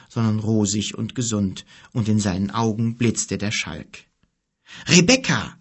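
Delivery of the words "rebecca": higher, louder glad call of a name; joyful cry. A narrator's reading. Kim greets his healed sister with a joyful cry. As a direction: cry out her name in delight. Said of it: "rief Kim erfreut".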